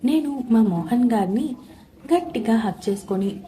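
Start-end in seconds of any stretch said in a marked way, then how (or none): none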